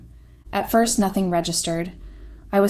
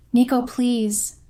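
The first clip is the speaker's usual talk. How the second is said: begging; 6 semitones higher, 4 dB louder, much slower than usual. A narrator's reading. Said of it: "I begged".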